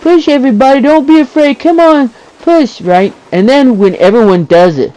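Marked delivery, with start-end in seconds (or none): 0.0-2.8 high-pitched mock rallying cry; imitated yelling. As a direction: imitate the pushy guy in chat. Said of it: "one guy in the chat yelling".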